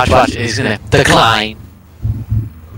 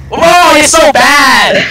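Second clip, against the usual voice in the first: high slow laughing shout; 12 semitones higher, 7 dB louder, much slower than usual. Real, not acted. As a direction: yell it out, cracking up. Laughing at a game mistake.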